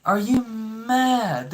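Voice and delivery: drawn-out, high, disbelieving question; are you mad, slow and high; incredulous. Real, not acted. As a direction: stretch it out in total disbelief. Ranting at TikTok guy, incredulous.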